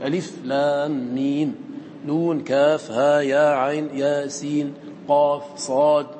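letter names from ألف to صاد slowly, each closed without a vowel; vowelless letter recitation. Shown as a demonstration. Teacher showing students how opening letters are read, slow recitation. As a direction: chant the letters one by one, long vowels, bare endings. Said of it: "هكذا تقرأ ساكنة".

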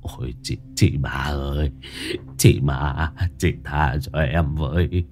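stammering plea. A narrator's reading. Old man stammers, pleading with the ghost. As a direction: babble out a frightened promise. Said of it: "miệng lắp bắp".

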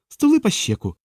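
high-pitched, snapping order; losing her temper. A narrator's reading. Diana cuts off Hermund's objections, high and snappish. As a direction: temper breaks, pitch leaps up. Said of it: "знову зірвалася пана Діана".

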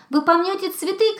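a high anxious yell; shouting. A narrator's reading. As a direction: warn them off the flowers. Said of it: "кричал ёжик".

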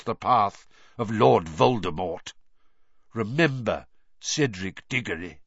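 solemn, measured speech to the hall; grave old headmaster. Acted. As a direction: speak like a grieving old wizard. Dumbledore's farewell feast speech.

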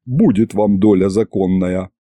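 usual speaking voice

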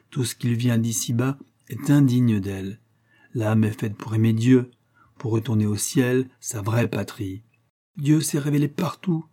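abbé grave qui sermonne, voix plus aiguë; prêcher comme un vieil abbé érudit; voix de l'abbé. Acted. Abbé fait la leçon. (l'abbé fait la leçon au chevalier, en sermonnant)

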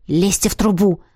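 restrained, firm order; understated commanding tone. A narrator's reading. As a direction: order them briskly to move. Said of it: "скомандовал Лин".

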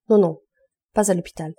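fierce, loud refusal; wife forbidding the hospital, loud and fierce; violently. A narrator's reading. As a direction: refuse them outright, no discussion. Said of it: "en disant violemment".

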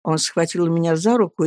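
plain reading voice

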